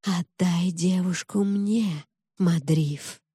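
slow, sinister, slithering rustle of a snake woman; rustling snake voice. A narrator's reading. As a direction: draw the words out like snake coils. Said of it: "Её голос шелестел змеиными кольцами".

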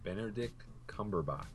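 put-on very soft, half-hearted British accent; failed British accent. Co-host poses as British actor, half-hearted British accent. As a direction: barely attempt a British accent, quietly. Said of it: "You weren't even doing a British accent".

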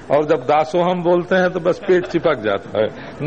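usual speaking voice

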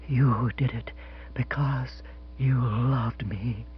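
soft, slow, intimate whisper; low vibrant whisper. Acted. Missus Spotsworth tells the captain he loved her, whispering softly. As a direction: breathe it to him like a secret. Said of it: "in a low, vibrant whisper".

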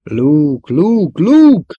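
insistent calls climbing high and loud; repeated shouting. A narrator's reading. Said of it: "A falak incselkedve visszhangozták a nevet". (egyre hangosabban kiáltozva)

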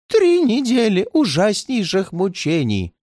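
high, slow moan of suffering. A narrator's reading. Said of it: "простонал старик".

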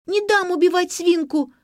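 put-on high, loud child's tantrum; stamping protest. A narrator's reading. Girl defends the pig from slaughter, loud high protest. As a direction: protest shrilly like a small child. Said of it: "затопала ногами Лера".

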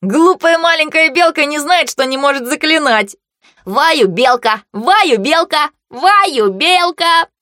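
put-on high, loud child's taunt; teasing boy. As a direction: tease like a big brother. Brother teasing little sister.

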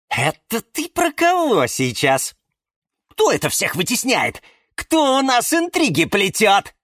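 high-pitched gossipy questioning; pry eagerly, voice pitched high; intrigued. A narrator's reading. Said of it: "заинтересовался Жорж".

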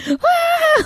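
put-on high-pitched little-girl scream, held back in volume; mock girlish scream. Podcaster mimicking the character's scream, high girlish shriek. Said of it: "like a little girl".

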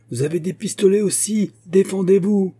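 shouted challenge. A narrator's reading. Musketeer challenging his armed enemy, shouted challenge. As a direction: call out a challenge, loudest at the end. Pursuer challenges fleeing man.

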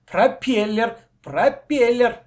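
loud, high, repeated insistence; childish argumentative chant; stubborn insisting. A narrator's reading. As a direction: hammer the word out stubbornly. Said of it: "не согласился Коля".

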